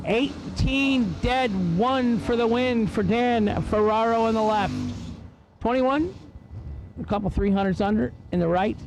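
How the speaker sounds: race announcer. Acted. Announcer to the grandstands, calling each run's numbers in an announcer read. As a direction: drag strip PA announcer calling times.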